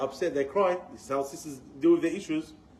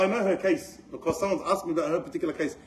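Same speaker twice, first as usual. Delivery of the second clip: ordinary talk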